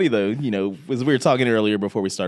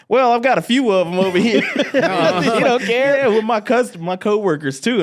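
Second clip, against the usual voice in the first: high, loud voice breaking into laughter; loud, unguarded reply; 11 semitones higher, 3 dB louder than usual. Retold or imitated. Barista answering a whispered question, loud and careless. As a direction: blurt it out for all to hear.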